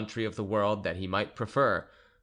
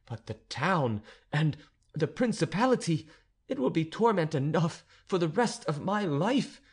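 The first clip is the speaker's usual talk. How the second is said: raised, indignant condemnation; horrified countess; 8 semitones higher than usual. Acted. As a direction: cast the infamous tutor out. Countess banishes the Abbé.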